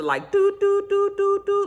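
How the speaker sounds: busy tone doo doo hummed very high; imitated busy tone. Describing a blocked call, high imitated busy-signal beeps. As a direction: beep like a busy phone line. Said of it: "like it was busy".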